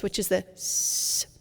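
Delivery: "s-" held as a slow, long hiss; "s-" hissed slowly and at length. Shown as a demonstration. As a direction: draw out the "s-" into a hiss. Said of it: "slight hissing on an S or Z sound".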